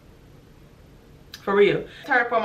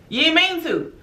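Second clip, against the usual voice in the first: high, sarcastic echo of an excuse; mocking disbelief; 8 semitones higher than usual. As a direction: throw the excuse back in a raised pitch.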